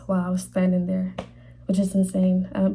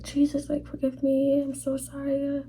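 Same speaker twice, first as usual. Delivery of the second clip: mild retold pleading; 6 semitones higher than usual. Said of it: "they were just in tears".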